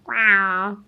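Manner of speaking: lightly voiced weird cat noise. Shown as a demonstration. Teacher showing the throat position, cat noise. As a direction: imitate a cat's throaty wow. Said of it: "weird noise that your cat sometimes makes".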